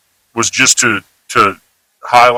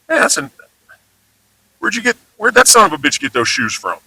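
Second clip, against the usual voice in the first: casual example; 4 semitones higher, 3 dB quieter, faster than usual. Shown as a demonstration. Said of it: "Just neutral".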